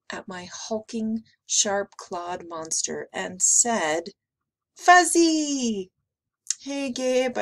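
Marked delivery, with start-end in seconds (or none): 4.7-5.9 put-on high, loud little-girl squeal; delighted child. A narrator's reading. Emma peering at the hulking monster, delighted squeal. 6.6-7.4 higher, upbeat call; cheering. A narrator's reading. Babysitter greeting Gabe, lightly cheering. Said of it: "I cheered".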